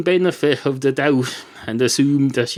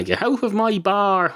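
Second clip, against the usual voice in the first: higher-pitched "get out of my bar"; insistent order; 9 semitones higher than usual. Acted. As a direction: repeat the order with the pitch lifted. Barman repeats order to leave.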